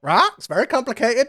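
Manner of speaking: squeaky, high Lintu voice; a squeaky little bird creature fretting. Acted. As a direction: pitch it way up, chirpy.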